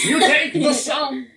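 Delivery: high and loud, slow with a laugh; incredulous, laughing it out; laughing disbelief. Real, not acted.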